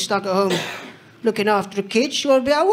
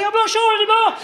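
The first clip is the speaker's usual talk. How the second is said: mock exasperation; 10 semitones higher than usual. Exasperated dad to nagging toddler.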